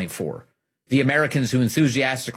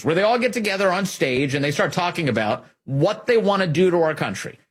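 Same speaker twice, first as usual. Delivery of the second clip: ordinary delivery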